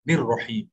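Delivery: निर्रहीम with n running straight into a doubled r; n joined to r. Shown as a demonstration. Teacher shows noon joining re, linked pronunciation. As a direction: link the n directly to the r in निर्रहीम. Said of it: "रे से मिला देंगे".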